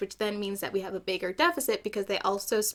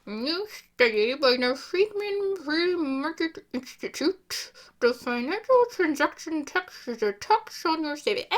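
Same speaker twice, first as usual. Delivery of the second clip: news anchor voice; 5 semitones higher than usual. Acted. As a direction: announce it like a TV reporter. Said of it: "Oh, I need a different voice".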